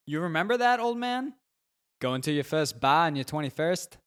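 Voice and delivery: put-on loud, high tipsy New England accent; drunk New England accent. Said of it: "his New England accent even thicker from the booze".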